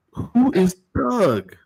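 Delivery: high, slow baffled "Who is Doug?"; confused. Retold or imitated. Co-host retelling her confusion at coworker's word, high and slow. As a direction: drag it out, totally lost. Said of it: "I'm like".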